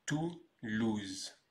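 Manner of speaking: TOULOUSE split as Tou, louse; slow TOULOUSE, pause between the two syllables; syllable-by-syllable demo. Shown as a demonstration. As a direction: break TOULOUSE into two parts for learners. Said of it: "Et on prononce donc".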